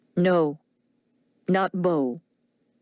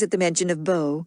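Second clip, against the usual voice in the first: plain reading voice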